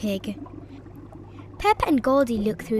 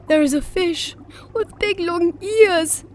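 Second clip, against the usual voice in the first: surprised, higher and louder; gasping; 7 semitones higher, 6 dB louder than usual. A narrator's reading. Daddy Pig gasps at the strange fish, surprised. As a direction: gasp out the discovery. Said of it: "gasps Daddy Pig".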